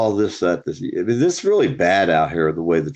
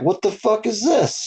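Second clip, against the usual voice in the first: much higher and slow, disbelieving; baffled exclamation; 13 semitones higher than usual. Retold or imitated. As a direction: drag it out, hands up in confusion. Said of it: "holding his both hands in the air like".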